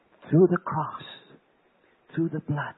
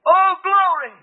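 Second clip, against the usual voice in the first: very high, loud burst of glory; ecstatic cry; 16 dB louder, slower than usual. Retold or imitated. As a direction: let it fly out in rapture. Ecstatic answer to the voice.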